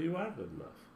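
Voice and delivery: usual speaking voice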